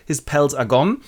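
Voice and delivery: usual speaking voice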